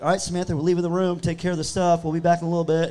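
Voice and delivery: mock talking to ghost.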